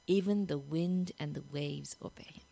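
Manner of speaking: hushed, breathless whisper; awed whisper. Acted. Terrified disciples whispering to each other, awed. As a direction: whisper it in fearful awe.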